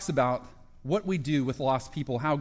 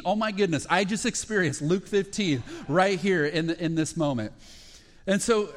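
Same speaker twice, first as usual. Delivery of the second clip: ordinary delivery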